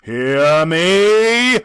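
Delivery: loud commanding cry. A narrator's reading. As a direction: demand to be heard. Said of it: "cried the ghost".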